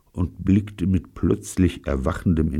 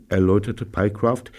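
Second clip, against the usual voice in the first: plain reading voice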